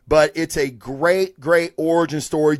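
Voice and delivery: ordinary talk, nothing marked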